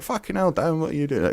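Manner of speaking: high-pitched put-on Cockney greeting; exaggerated Cockney, pitched well up; mock Cockney. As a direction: imitate a friend in an unintended Cockney accent. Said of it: "He's not a Cockney".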